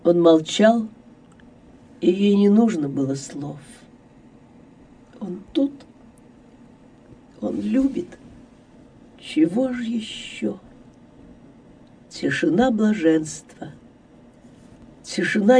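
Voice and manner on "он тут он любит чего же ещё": soft, unhurried inner voice; softly savouring happiness; hushed bliss. A narrator's reading. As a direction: murmur it in quiet wonder, with pauses. Heroine's blissful thought in silence.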